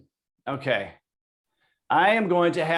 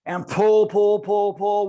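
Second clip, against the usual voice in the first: urging shout; 4 semitones higher, 5 dB louder, slower than usual. Real, not acted. Coach urging a hard pull.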